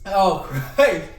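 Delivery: pained, half-laughing breaths; hurts to breathe. Real, not acted. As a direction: speak through breathing pain. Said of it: "it hurts when I breathe".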